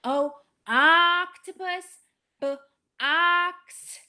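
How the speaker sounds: slow, high "Oh, octopus" then "ox"; teacher links vowel to word; blending o into box. Shown as a demonstration. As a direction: stretch and blend the o. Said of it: "make that connection".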